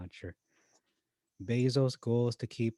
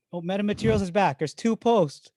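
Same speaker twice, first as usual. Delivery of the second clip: high, loud, quick excitement; thrilled to see it back; excited; 9 semitones higher, 9 dB louder than usual. Real, not acted.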